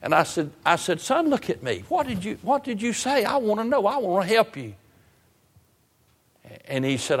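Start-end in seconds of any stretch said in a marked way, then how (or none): 1.0-4.7 very high, insistent appeal; urgent plea. Retold or imitated. Preacher urging the mumbling young man to speak, pleading. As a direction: press him to answer, pitch held high.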